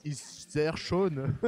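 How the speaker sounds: slow, drawn-out mock German accent. Co-caster joining in with a slow mock German accent. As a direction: ham up a German tourist's praise, slowly. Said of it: "fais-moi l'accent allemand".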